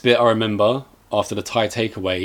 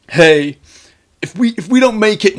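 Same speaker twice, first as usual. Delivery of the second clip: high, loud hammed-up plea; mock melodramatic farewell; 10 semitones higher, 6 dB louder than usual. Trapped friends saying goodbye, mock melodramatic farewell. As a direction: imitate a doomed film hero's last words. Acting doomed characters' farewell speech.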